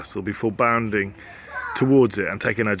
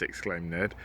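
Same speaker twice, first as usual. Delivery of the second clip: usual speaking voice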